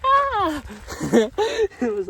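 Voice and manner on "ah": high, loud squeal of delight; kids' excited shriek. Retold or imitated. Children in the mall squeal at the mime, high and loud. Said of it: "all these kids are just like".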